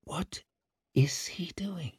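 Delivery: barely voiced question; muttering. A narrator's reading. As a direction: mutter it to yourself under your breath. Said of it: "muttered Savine".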